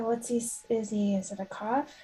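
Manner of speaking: ordinary delivery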